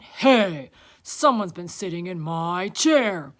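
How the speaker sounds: big, loud bear voice; Papa Bear. A narrator's reading. Papa Bear looking at his chair, loud indignant voice. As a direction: boom it out, indignant. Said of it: "Papa Bear went to look".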